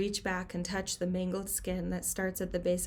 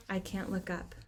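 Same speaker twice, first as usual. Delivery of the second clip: plain reading voice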